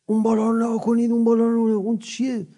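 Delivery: high, loud urgent cry of danger; alarmed shout. Retold or imitated. Pickpocket shouting at the crowd, mock alarmed yell. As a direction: yell to pull the crowd's eyes upward. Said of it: "داد زد به همه یه جوری محکمی".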